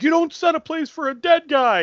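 angry outburst. Retold or imitated. Kid flipping out.

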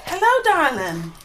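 high, coy seductive greeting; femme fatale. Acted. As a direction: purr it like a courtesan. Courtesan greets guards.

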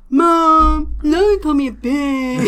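loud, whiny tattletale voices; whiny tattling kids. Retold or imitated. Mother imitating her sons tattling to her. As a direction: tattle to mom like a wronged kid.

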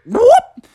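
high, loud whoop; rising cartoon whoop; whoosh effect. A narrator's reading. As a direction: make a quick snatching noise. Mimics snatching sound.

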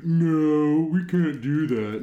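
loud, slow mock refusal. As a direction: bark out a flat no. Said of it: "be like".

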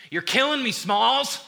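high, loud mock outburst; exasperated movie shout. Preacher quotes Sandlot for the congregation, high exasperated yell.

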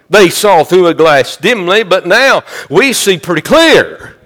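Southern-pulpit sermon cadence; impassioned preaching. Real, not acted. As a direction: preach it out with ringing conviction, drawing out key words. Preaches at full force.

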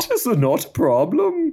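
a hint of a German accent, reassuring her. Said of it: "take it kind of in a Flula Borg direction".

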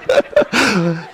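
a short real laugh at himself. Real, not acted. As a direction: break into a sheepish laugh. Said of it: "The Canadian accent's difficult".

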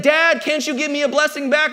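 high-pitched mock complaint; angry. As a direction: act out an upset son pleading with dad. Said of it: "he's angry, and he's like".

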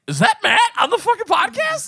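high, loud disbelief; incredulous. Retold or imitated. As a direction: squeak it in disbelief. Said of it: "And I'm like".

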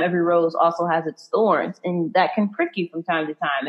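normal talking voice

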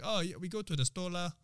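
a hint of Singaporean accent; Singlish. Showing a friend how Singaporeans use la, light Singlish. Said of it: "they say la a lot".

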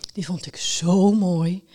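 slow, dreamy sigh. A narrator's reading. Boy on a scooter remembers the book, sighing. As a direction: sigh it out with a long middle. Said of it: "zuchtte hij".

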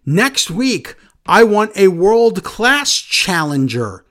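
slow, drawn-out mock tough-guy promo; lightly hammed-up slow wrestling promo voice; mock Damian Priest promo. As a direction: drag each word out like a self-serious wrestler. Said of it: "Damian cuts a promo saying".

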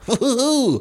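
high playful woo-hoo; playful whoop. Pretend. Guest pretends to lift his kilt, high playful whoop. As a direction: whoop in mock cheekiness.

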